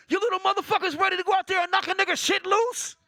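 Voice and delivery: put-on high, loud coach yelling at little kids; hyped-up coach. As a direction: bark it like a fired-up youth football coach. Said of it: "He said".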